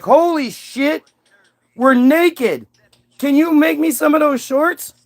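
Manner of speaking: high, drawn-out astonishment; stunned realisation. Acted. Adam discovering he's naked, hammed-up amazement.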